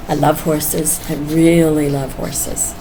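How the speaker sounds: ordinary talk, nothing marked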